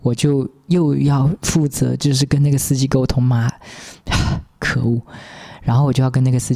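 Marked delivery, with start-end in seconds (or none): none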